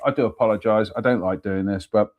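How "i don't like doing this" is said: usual speaking voice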